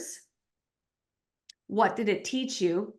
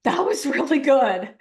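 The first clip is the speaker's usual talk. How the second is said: delighted; 6 dB louder, slower than usual. Retold or imitated. Climber reaching the seventh stair, slow and louder with delight. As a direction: savour the moment of success. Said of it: "we go".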